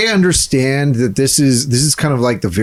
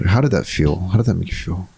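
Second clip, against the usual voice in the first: therapist voice; 8 semitones lower, 5 dB quieter than usual. Pretend. Mock therapist question.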